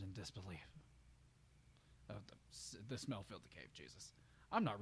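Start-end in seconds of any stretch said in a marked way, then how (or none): 2.1-4.1 disgusted mumble. Real, not acted. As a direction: trail off in disgust. Reader disgusted, trailing off.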